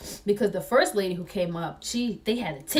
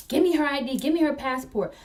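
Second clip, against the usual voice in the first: higher, insistent mock-officer demand; mildly raised imitation of yelling; retold yelled demand; 6 semitones higher than usual. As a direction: demand it like a pushy cop. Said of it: "they were yelling at the owners".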